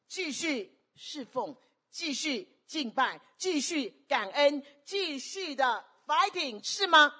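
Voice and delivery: slow, high, building exhortation; emphatic sermon rallying cry; rallying preacher. Real, not acted.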